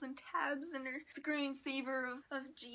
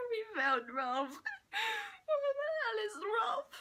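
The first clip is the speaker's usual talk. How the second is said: mock shouting; 10 semitones higher, 5 dB louder, slower than usual. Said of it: "he's like".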